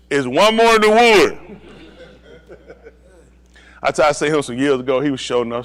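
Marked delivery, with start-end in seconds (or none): 0.1-1.6 loud, high, drawn-out shout; calling out. Retold or imitated. Comic retells someone telling on others, calling out. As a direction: holler it slowly.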